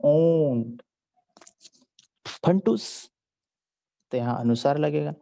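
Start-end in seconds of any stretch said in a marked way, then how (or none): 0.0-0.8 ऊंट said louder with a clear nasal vowel; correct pronunciation. Shown as a demonstration. Teacher models the correct ऊंट for the children. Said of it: "लेकिन हमको क्या बोलना?".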